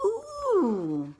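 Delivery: high, loud drawn-out "ooh"; little girl's "ooh". A narrator's reading. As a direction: squeal a long "ooh" like a little girl, sliding down. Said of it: "this little girl says".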